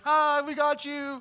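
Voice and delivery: high, slow mock prank-show host; lightly voiced cheerful prankster; prankster reveal. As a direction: chirp it like a hidden-camera host. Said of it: "Somebody's gonna come out and be like".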